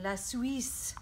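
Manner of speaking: "La Suisse" said the French way, "la swees"; a gentle French accent on the country's name. Acted. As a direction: give "La Suisse" a light French lilt. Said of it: "in my best French accent".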